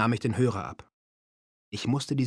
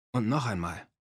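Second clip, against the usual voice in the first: ordinary delivery, nothing marked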